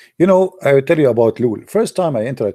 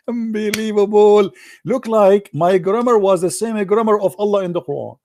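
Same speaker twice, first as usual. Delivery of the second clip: amused disbelief; 9 semitones higher than usual. Acted. Man comparing his grammar to the Quran's, amused disbelief. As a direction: marvel in a squeaky raised pitch.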